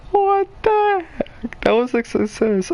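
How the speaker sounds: high, slow, laughing "what the heck"; laughing disbelief. Real, not acted. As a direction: drag it out while cracking up. Laughing reaction to the fart.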